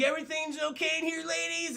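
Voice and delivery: high, cocky cop greeting; smug cop. Retold or imitated. Bluestone barging into the chief's office, high and cocky. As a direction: swagger in checking on the ladies.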